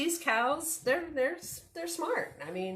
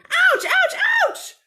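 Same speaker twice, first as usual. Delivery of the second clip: high, loud string of yelps; hammed-up storybook pain; 11 dB louder than usual. A narrator's reading. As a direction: yelp at each peck. Character being pecked by chickens.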